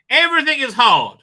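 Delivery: loud, high-pitched melodramatic wail; self-narrated melodrama, loud and high; mock crying out. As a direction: cry it out in hammed-up despair. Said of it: "she cried".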